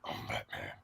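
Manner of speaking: Batman growl. Retold or imitated. Mimicking voice changer Batman.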